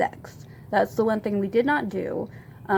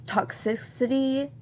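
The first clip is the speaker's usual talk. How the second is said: toxicity said aloud, higher and questioning; unsure word check; 5 semitones higher than usual. Real, not acted. Trying out a doubtful word aloud.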